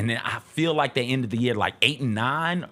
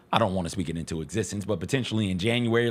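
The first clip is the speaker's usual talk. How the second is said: usual speaking voice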